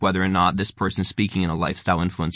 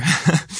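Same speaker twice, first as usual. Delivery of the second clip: short laugh; 7 semitones higher than usual. Real, not acted. Laughing at her own word.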